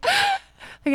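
sharp high mock gasp; retold gasp. Preacher reacting to the Bible verse with a mock gasp. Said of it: "And I was like".

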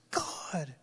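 breathless whisper of God; whispered plea. Retold or imitated. Preacher whispering a prayer in the week's strain, hushed. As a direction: whisper it like a private desperate prayer. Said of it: "I was just like".

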